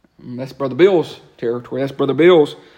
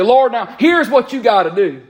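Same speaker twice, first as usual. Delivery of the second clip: bossy demand; 8 semitones higher, 5 dB louder than usual. Retold or imitated. Mock bossy prayer to God.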